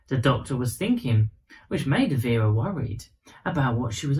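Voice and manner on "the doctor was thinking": usual speaking voice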